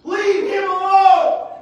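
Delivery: high, loud and slow shout. Acted. Disciples ordering parents away, loud slow shout. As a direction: drag out the dismissal at full volume.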